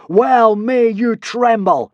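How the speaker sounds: slow, loud, high divine bellow. A narrator's reading. Hiccup as a god bellowing at the cowering Romans. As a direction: thunder it down at them. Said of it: "bellowed Hiccup".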